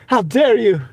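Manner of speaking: high, hammed-up "How dare you?" with HOW pushed way up; mock outrage. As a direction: play a scandalised drama queen for laughs. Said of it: "We can do the How Dare You".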